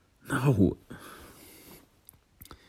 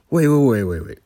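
flustered wait; 5 semitones higher, 10 dB louder than usual. Acted. Stunned by proposal.

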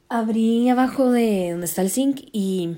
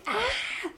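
imitating a high scream of fright; 7 dB quieter than usual. Scared of a huge spider, a high shrieking scream. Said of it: "empecé a gritar".

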